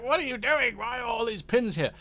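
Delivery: high-pitched voice of an overworked computer; struggling computer. Pretend. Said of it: "my computer's struggling. It's like".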